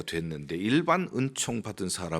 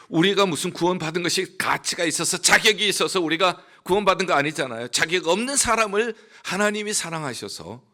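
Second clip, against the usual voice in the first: 힘찬 설교; 8 semitones higher, 7 dB louder than usual. Acted. Preaching at full force.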